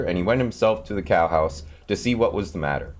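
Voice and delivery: ordinary delivery, nothing marked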